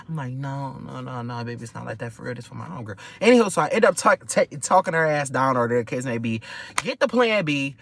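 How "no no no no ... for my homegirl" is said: soft. Retold or imitated. Sweet-talking the angry cashier.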